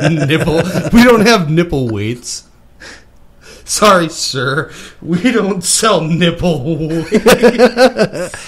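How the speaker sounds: laughing through a mock polite clerk reply; laughing clerk line. Mimicking the store clerk to his friend, laughing through it. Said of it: "mock clerk reply while laughing".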